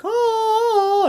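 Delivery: high, loud wailing "oh"; mock siren call. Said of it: "and she's like".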